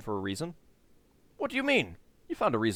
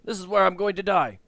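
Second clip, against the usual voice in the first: bitter; 8 semitones higher, 6 dB louder than usual. Acted. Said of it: "He laughs bitterly".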